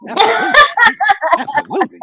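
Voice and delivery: laughing while repeating the word. Real, not acted. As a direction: laugh through it, rising.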